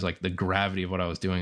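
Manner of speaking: ordinary delivery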